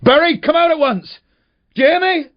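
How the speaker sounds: high, loud, furious shouting into the dark; enraged shout. Acted. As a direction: scream at the hidden boy in a rage. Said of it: "enraged, shouting into the dark silence".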